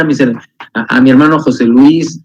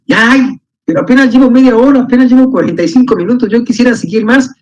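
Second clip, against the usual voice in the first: eager lament; 7 semitones higher than usual. Retold or imitated. Imita el ansia de seguir leyendo.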